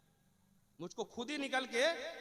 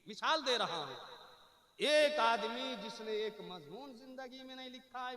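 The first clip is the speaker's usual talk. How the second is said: usual speaking voice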